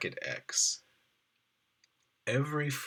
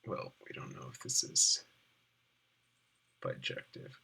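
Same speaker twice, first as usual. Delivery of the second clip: whispering; 8 semitones lower, 5 dB quieter than usual. Real, not acted. Aside, unsure of math.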